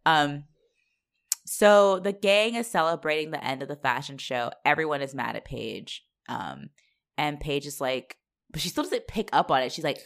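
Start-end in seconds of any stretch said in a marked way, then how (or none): none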